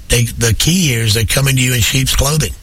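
ordinary talk

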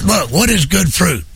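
ordinary talk

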